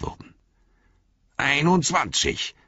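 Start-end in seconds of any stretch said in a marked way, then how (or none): 1.4-2.5 much higher, slow triumphant call; dwarf calling his kill count mid-battle. A narrator's reading. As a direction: announce the count proudly over the fight. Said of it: "rief Gimli".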